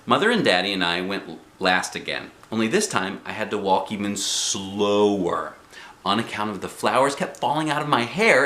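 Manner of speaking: ordinary delivery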